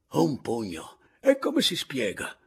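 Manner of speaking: loud, high-pitched dismay; utter consternation. A narrator's reading. Signor Anselmo keeps repeating it after the punch, appalled. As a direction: exclaim it, climbing into a baffled question. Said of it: "costernatissimo, badava a ripetere".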